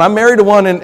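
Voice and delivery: normal talking voice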